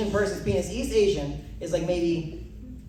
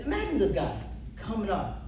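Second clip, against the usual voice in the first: ordinary talk, nothing marked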